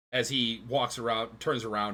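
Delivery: normal talking voice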